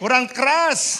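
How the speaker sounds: loud, high, drawn-out prod; slow, forceful shout to rouse the crowd; demanding shout. Real, not acted. Preacher telling the congregation it was not loud enough, demanding shout.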